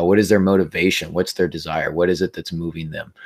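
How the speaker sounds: ordinary talk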